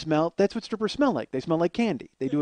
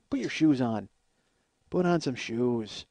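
normal talking voice

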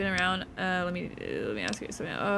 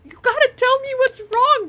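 exasperated yell; 8 dB louder than usual. Real, not acted. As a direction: yell it in frustration. Yelling at the crying child.

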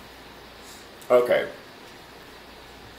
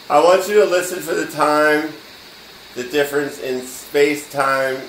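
louder emphasis; 5 semitones higher, 5 dB louder than usual. Shown as a demonstration. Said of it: "repeats instruction louder".

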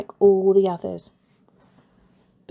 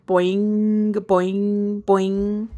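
springy boing noises; bouncing sound; much slower than usual. A narrator's reading. Narrator imitating balls bouncing, springy boings. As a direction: bounce each boing like a ball.

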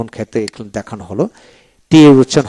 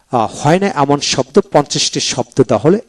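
ordinary talk

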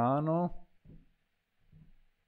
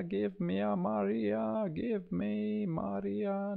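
"give me Maria" lifted much higher, slow and playful; high singsong plea; 10 semitones higher, 4 dB quieter, slower than usual. Pretend. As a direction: coax the software in a high sung-out voice. Asking the software for Maria.

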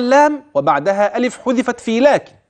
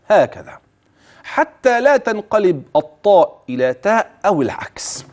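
ordinary talk, nothing marked

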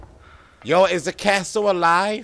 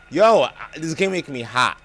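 usual speaking voice

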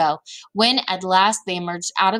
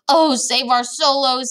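loud, slow, raised wail; storybook giant pleading for rescue; distressed cry; 5 semitones higher, 5 dB louder, slower than usual. A narrator's reading. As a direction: wail it out in dismay. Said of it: "he cried".

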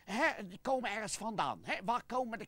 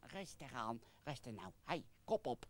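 much lower and softer, calming; soothing calm-down; 9 semitones lower, 10 dB quieter than usual. Acted. Puppet calming the angry oma Gent, soft and low. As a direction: settle him down gently.

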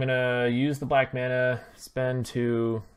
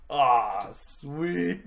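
ordinary delivery

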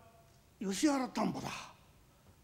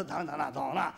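ordinary talk, nothing marked

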